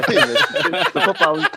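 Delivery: high squealing laugh into the words; real laughter. Real, not acted. Cohost laughing off the teasing about his accent, squealing. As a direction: laugh hard while protesting.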